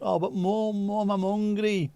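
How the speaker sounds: put-on high, drawn-out pleading kid voice; mock little-boy whine, held high; eight-year-old child. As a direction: imitate a child pleading with mum. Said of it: "at the age of eight".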